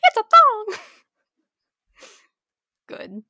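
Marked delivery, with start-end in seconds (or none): 0.0-0.8 much higher, louder squeaky puppet voice; loud, high Pinocchio squeak. Pretend. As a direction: play the little wooden boy confessing. Continuing the Pinocchio bit.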